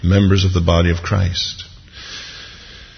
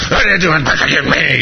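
high-pitched mock-furious demand; hammed-up wrathful scolding; angry voice from heaven; 7 semitones higher than usual. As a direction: play an outraged God, overdone. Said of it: "I don't think this is angry".

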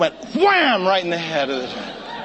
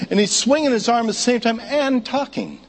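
ordinary delivery

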